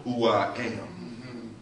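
low, soft, slow pronouncement; slow right down, deep and hushed; calm voice of God. Acted. God naming himself to Moses, slow and calm. Acts God's calm reply.

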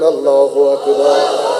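slow, stronger call to the congregation; slow, drawn-out rallying cry; bigger takbir. Shown as a demonstration. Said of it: "আরো বড় করে বলেন".

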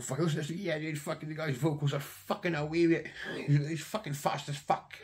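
high excited mate impression; imitating his mate. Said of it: "that's exactly how I said it".

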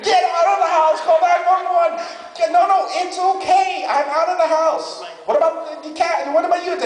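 high-pitched panicked back-and-forth; acted hysterical alarm, voice way up; frantic shouting. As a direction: yell urgently over each other on the phone. Frantic phone exchange about house fire.

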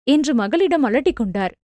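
plain reading voice

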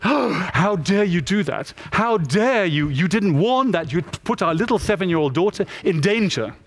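mock-scandalised reproach with heavy emphasis; shocked indignation. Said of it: "her parents looked at me and were shocked".

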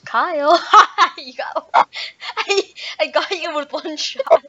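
loud laughing gloat. Real, not acted. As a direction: crow through laughter. Laughing triumph over kill.

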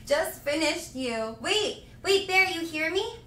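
ordinary talk, nothing marked

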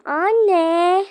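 loud, high call across the house. Pretend. Girl calling for her mother, loud high call. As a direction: call mom from afar.

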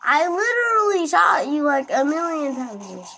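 high, loud outraged yell at the enemy; frustrated yell. Real, not acted. Frustrated gamer shouting at enemy.

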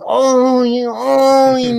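high, loud imitated moans; mock moans. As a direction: ham up the neighbour's moaning. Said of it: "y oye esas cosas". (gemidos fingidos, agudos y fuertes)

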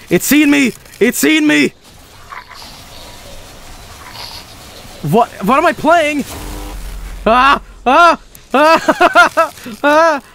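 high panicked cries; scared horror game streamer. Real, not acted. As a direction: shriek in terror, half laughing. Monster spots player in game.

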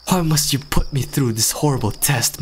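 usual speaking voice

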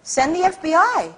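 high, breezy mock-casual Iraqi reply; Iraqi officials' reply. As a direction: toss it off high as if it were obvious. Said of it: "the message from the Iraqis".

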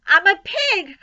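put-on high, loud boyish cry; gleeful cry. A narrator's reading. Boy answering his scolding mother, crying out. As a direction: call it out proudly and playfully. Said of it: "cried Avery".

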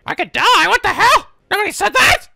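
high panicked squawk; mock panicked monkey. Viewer voicing the monkey, high and panicked. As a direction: squeal it like a scared monkey. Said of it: "The monkey's like".

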